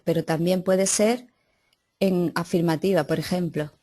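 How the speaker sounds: normal talking voice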